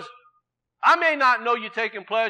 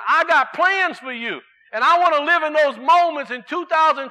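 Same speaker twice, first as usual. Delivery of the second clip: raised, ringing sermon voice; preaching; 4 semitones higher, 5 dB louder than usual. Acted. Preacher urging the church to bring peace, preaching. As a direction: preach it to the congregation.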